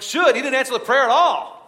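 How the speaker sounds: high, loud exasperated cry; aggrieved outburst. Retold or imitated. A believer protesting unanswered prayer, high and loud. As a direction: let the frustration burst out.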